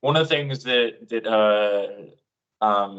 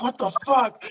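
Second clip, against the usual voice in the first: stunned friend; 11 semitones higher than usual. Retold or imitated. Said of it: "He's like".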